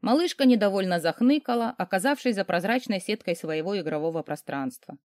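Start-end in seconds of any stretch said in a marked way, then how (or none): none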